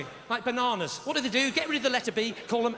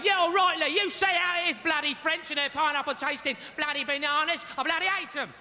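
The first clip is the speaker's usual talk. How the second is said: indignant Londoner, higher-pitched; raised-pitch London geezer mocking the crowd; London accent; 6 semitones higher than usual. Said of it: "that's how they speak in London".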